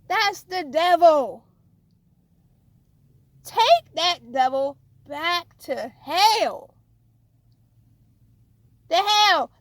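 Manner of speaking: loud, slow preaching rebuke; forceful rebuke. Real, not acted. Preacher rebukes the devil before the congregation, loud and slow.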